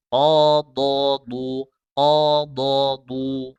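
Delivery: slow qadadu with heavy du; drill qadadu. Shown as a demonstration. Teacher shows the practice drill, slow. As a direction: qadadu drawn out, thick du. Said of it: "begitu cara latihannya".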